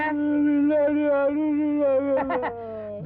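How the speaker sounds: long, drawn-out mock howl; acted pain howl. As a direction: howl as if kicked from behind, hammed-up. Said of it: "பின்னால அடி மிதிச்சிட்டாங்க, என்ன பண்ணுவீங்க". (அடி வாங்கியது போல நடிப்பு அலறல்)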